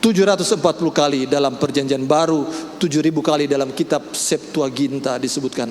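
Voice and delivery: ordinary talk, nothing marked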